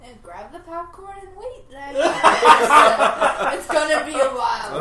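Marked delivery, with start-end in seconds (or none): 2.0-4.8 laughing. Real, not acted. Laughing through the words.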